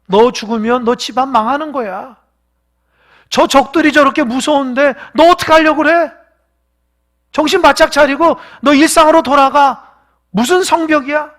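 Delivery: high, loud pressing plea; urgent pleading. Acted. Relative pressing a worker to abandon the wall, urgent pleading. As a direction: scare him into quitting.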